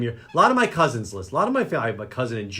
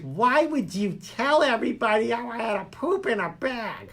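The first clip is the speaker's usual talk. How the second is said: higher mock voice of an upset mother; mom voice; 10 semitones higher than usual. Son imitating his mother complaining about the poop story, mock mom voice. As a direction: imitate mom scolding, hammed up. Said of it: "She's like".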